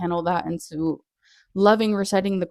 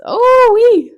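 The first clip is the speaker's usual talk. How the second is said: high, loud squeal of excitement; a sudden shrieked oh wee; excited whoop; 13 dB louder than usual. Real, not acted. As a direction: let out a gleeful whoop. Overwhelmed at thought of free time.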